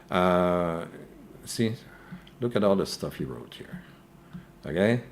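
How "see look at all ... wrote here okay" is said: ordinary talk, nothing marked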